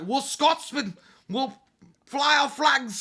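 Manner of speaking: put-on high, loud Scottish accent; proud Scottish accent. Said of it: "all I can do is like".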